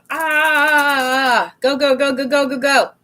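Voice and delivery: high, stretched cry into rapid go-go-go; mock frantic. Tarot reader imitating Libras' busy last weeks, mock frantic.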